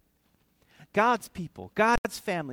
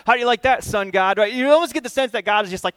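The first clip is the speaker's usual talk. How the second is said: ordinary delivery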